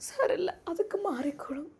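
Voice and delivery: hushed whisper, brushing off the pain. Acted. Injured mother reassures her son it will heal, whispered. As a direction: breathe it out, making light of the hurt.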